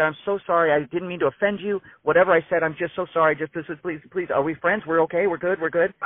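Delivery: high, frantic mock apology; imitating a scared record exec begging forgiveness; imitating terrified apology. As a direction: grovel in a panicked rush, voice up high. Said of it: "he looks terrified".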